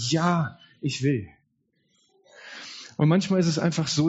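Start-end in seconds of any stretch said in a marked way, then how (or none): 0.1-1.3 slow, heartfelt „Ja, ich will“; heartfelt yes. Retold or imitated. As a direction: say it from the heart, slowly. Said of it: "von Herzen sagen". (von Herzen, langsam gesprochen)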